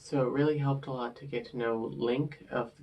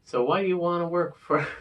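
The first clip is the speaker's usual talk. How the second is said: higher, louder interviewer voice; Sandra voice; 5 semitones higher, 6 dB louder than usual. Retold or imitated. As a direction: put on a brisk boss's interview voice. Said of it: "do my best Sandra voice".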